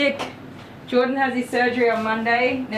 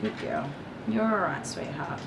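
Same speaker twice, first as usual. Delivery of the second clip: soothing praise; 2 semitones lower, 5 dB quieter than usual. Real, not acted. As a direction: settle her with gentle praise. Soothing the dog.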